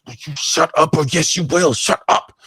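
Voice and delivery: snapping at his partner to shut up; angry hush-up. Acted. Thug silencing his partner.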